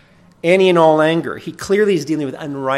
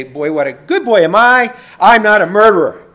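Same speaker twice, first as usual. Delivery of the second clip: loud, higher, self-satisfied boast; mock smug self-congratulation; smug self-praise; 7 semitones higher, 10 dB louder than usual. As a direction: crow about your own goodness. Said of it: "should I pat myself on the back and say".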